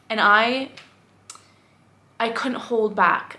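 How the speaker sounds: ordinary delivery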